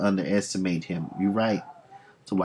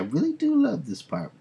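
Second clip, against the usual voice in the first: giddy, much higher and bright; 10 semitones higher than usual. Retold or imitated. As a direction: bounce it up high like a gleeful villain. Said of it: "he's giddy".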